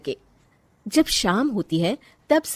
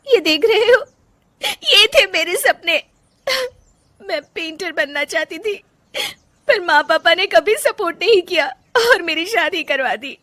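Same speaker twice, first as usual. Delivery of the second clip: much higher voice, sobbing through the words; crying confession; 10 semitones higher, 4 dB louder than usual. Acted. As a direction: pour out broken dreams in tears. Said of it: "Rajni फूट फूट कर रोने लगती है". (रोते हुए दर्द भरी बात)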